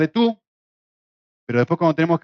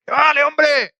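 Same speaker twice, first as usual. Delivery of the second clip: mock shout; 9 semitones higher, much slower than usual. Workers shouting over each other.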